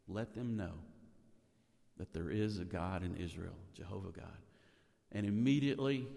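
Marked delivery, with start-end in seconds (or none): 0.1-4.4 softer and lower, fading almost to nothing; hushed prayer. Acted. Elijah prays his one simple sentence to God, hushed. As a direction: pray it simply and intimately.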